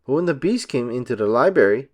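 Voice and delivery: usual speaking voice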